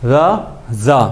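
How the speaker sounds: slow drill, light را then hard buzzing زا; soft then hard z. Shown as a demonstration. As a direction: say را gently, زا with firm pressure. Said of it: "زا کو سختی سے پڑھنا ہے".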